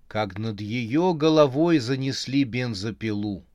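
ordinary delivery

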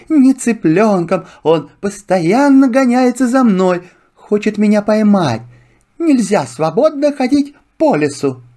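a fox griping about the hunter; complaining fox. Acted. (жалобный голос лисы)

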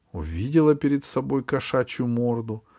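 ordinary delivery

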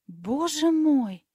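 slow, high and loud cry of mock alarm; dismayed exclamation. A narrator's reading. Fairy reacting to the boy's claim, exclaiming in theatrical dismay. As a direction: stretch it out as if scandalized. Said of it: "воскликнула злая фея".

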